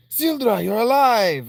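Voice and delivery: high, loud voicing; excited shout of relief; character voice. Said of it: "That's not what she sounds like".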